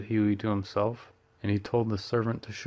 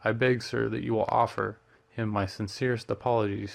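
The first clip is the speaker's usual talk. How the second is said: plain reading voice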